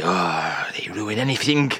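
ordinary talk

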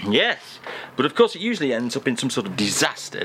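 usual speaking voice

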